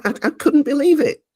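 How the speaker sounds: bright rush of disbelief; still amazed. Real, not acted. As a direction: relive the thrill.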